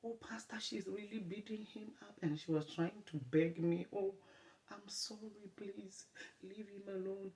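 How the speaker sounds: high, hushed imitation of the girl pleading, whispered in parts. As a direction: beg the pastor high and hushed.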